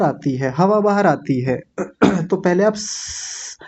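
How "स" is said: long soft hiss of स, no vowel; isolated s sound. Shown as a demonstration. Teacher asking students to copy, sustained soft स hiss. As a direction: push air through the teeth for स. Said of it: "ऐसा बोलिए".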